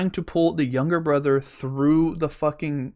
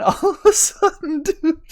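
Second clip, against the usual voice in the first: high, breaking up with laughter; real laughing speech; 6 dB louder than usual. Real, not acted. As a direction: laugh helplessly through the words. Cracking up at his own story.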